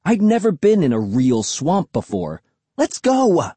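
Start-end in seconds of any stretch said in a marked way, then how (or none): 2.8-3.5 high, eager call to go; thrilled kid urging someone on; excited high-pitched cheer; really excited. A narrator's reading. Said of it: "I was really excited".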